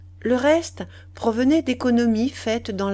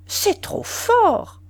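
slow, high outburst; exclaiming; 12 semitones higher, 4 dB louder, much slower than usual. A narrator's reading. Woman protesting to the young man's teasing, exclaiming. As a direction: cry it out, drawn out and high. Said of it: "Elle s'écria".

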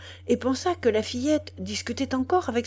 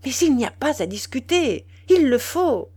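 impatient; 5 semitones higher, 6 dB louder than usual. Acted.

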